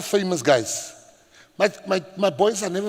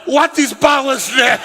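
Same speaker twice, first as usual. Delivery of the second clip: loud, high exasperated question; frustrated shout; 8 semitones higher, 7 dB louder, slower than usual. Retold or imitated. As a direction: shout it slowly in comic frustration. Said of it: "so I was frustrated with them".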